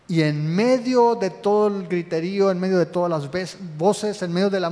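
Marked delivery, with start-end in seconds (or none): none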